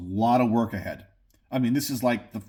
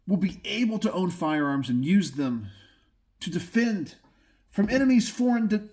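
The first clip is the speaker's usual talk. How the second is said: passionate; 5 semitones higher than usual. Real, not acted. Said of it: "if I sound passionate".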